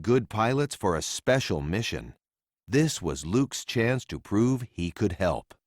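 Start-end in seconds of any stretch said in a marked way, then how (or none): none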